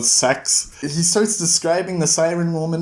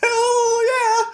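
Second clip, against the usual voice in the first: much higher whooping cheer; mock victory whoop; eager cheer. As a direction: jump at the bet gleefully. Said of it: "Kavoth and Tenpei are like".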